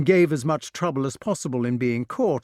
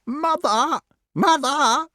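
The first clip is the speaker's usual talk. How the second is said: high piercing scream, louder; screaming crow; 6 dB louder, slower than usual. A narrator's reading. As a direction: shriek it at the top of your voice. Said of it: "screamed the crow".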